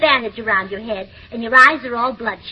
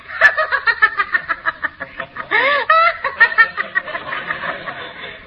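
high theatrical peal of laughter; hammy stage laugh; 8 semitones higher than usual. Acted. Wife rehearsing a scene, hammy stage laugh. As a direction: laugh like a silent-film heroine.

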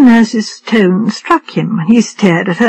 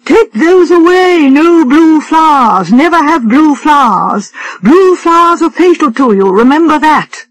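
cried out warning; 6 semitones higher than usual. Acted. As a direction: cry the warning out, higher and dragged. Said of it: "She pointed at them and cried out".

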